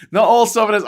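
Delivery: squeaky mock outrage; mock protest. Streamer mocking touchy southerners, high mock protest. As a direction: chant it like a silly slogan, high and loud.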